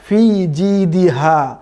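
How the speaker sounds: slow ফি জি দিহা with a firm open হা; hard ha. Shown as a demonstration. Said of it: "এটা হচ্ছে hard".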